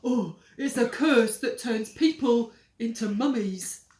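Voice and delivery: loud pleading rush; desperate. Acted. Henry desperately explaining, loud. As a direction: sell it desperately. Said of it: "Henry said desperately".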